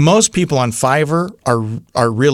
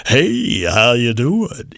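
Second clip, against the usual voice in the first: slow, hammed-up radio announcer voice; phony DJ voice; slower than usual. Shown as a demonstration. Voiceover coach mocking a phony radio voice. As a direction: croon it like a cheesy eighties DJ. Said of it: "a puker is that person that uses a phony radio voice".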